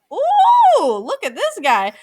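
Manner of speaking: high swooning ooh; mock-swoon at a hot picture. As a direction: gush over a handsome photo, loud. Swooning at hot profile photo.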